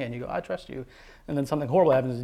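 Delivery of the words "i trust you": higher, light quick assurance; ready assurance. Retold or imitated. Believer first answers God's question, ready assurance. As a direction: answer easily, pitched up.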